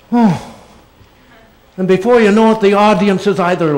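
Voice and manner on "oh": a single meaningful "Oh". Shown as a demonstration. Actor showing fans the power of one sound, a loaded "Oh.". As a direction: let the one sound "Oh." carry the whole scene. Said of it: "you can say".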